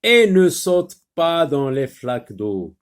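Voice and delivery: loud, projected classroom reading; emphatic dictation voice; teacher reading aloud. Shown as a demonstration. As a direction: read it out clearly for beginning readers. Teacher rereads the second sentence.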